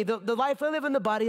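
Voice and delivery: ordinary talk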